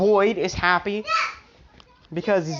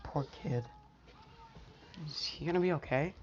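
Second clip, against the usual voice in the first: much softer, to herself; a mother's worried aside, kept low; worried murmur; 3 semitones lower, 14 dB quieter, faster than usual. Acted. Mom worrying to herself.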